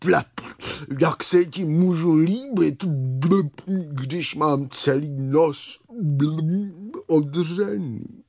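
slow words punctured by blubs; bubbling water creature. Acted. Water scorpion laments her scraped nose, bubbling. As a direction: burble the self-pity between bubbles.

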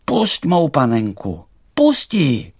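loud, high demand; calling out. A narrator's reading. Emanuel demanding the thistle free his doll, loud calling. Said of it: "a volá".

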